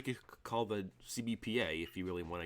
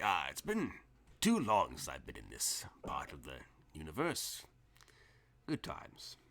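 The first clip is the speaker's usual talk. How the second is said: put-on wistful old traveler; 5 semitones lower than usual. GM voices Bashima sighing nostalgically.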